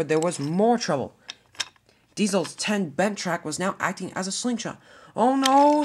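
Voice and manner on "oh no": alarmed cry. Acted. Diesel ten sees slingshot coming.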